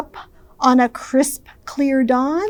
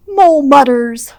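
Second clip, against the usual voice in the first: ordinary delivery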